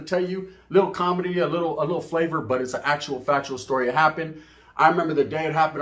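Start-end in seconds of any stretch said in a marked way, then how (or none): none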